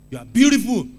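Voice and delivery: booming shouted praise; loud declaration. Shown as a demonstration. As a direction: belt it out at God. Demo of loud flat address to God.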